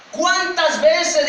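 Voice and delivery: high, loud, very slow cry; drawn-out impassioned plea; anguished lament. Acted. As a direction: wail it out in reproach. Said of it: "comenzó a llorar y dijo".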